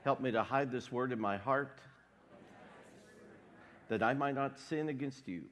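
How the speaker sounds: ordinary talk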